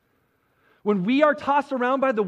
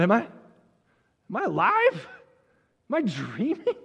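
dazed disbelief. Acted. Moses checking whether he is dreaming, dazed. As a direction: swoop up in question, as if doubting your senses. Preacher acts out stunned Moses.

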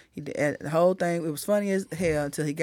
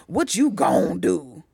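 slow mock imitation leaning hard on gon'; mock emphasis on gon'; 3 semitones lower, slower than usual. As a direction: drag out gon' slowly, mockingly. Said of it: "Who, who puts the emphasis on the "gon" like that?".

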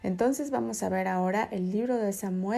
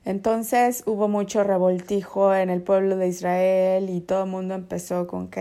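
ordinary talk

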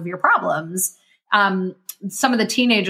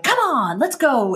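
higher, pumped-up rallying call; motivational cheer; 6 semitones higher than usual. Shown as a demonstration. Coach showing her motivational side, rallying cheer. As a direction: fire them up like a coach. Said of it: "motivational vibe that I really lean into".

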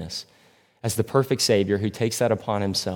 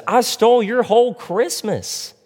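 high, louder desperate Grinch cry; cry of desperation; 12 semitones higher, 3 dB louder than usual. Retold or imitated. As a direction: wail it up high as a last desperate plea. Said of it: "cry of desperation".

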